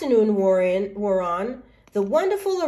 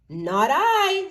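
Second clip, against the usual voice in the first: lazy dog; 8 semitones higher, 3 dB louder than usual. Acted. Dog refuses.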